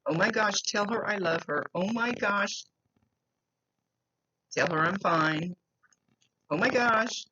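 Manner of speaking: high, gushing spirit relayed by medium; excited gushing. Retold or imitated. Medium passing on the spirit's excited words. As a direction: gush it out like an excited girl.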